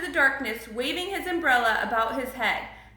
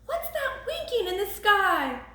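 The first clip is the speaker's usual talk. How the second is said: high-pitched, hushed-excited question; lightly voiced little George; wondering young George; 7 semitones higher than usual. A narrator's reading. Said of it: "whispered George".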